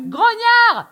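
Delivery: high-pitched shout down the street; high, drawn-out yell; street call. Shown as a demonstration. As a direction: call out GROGNARD as if hailing someone. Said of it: "essayé de crier dans la rue".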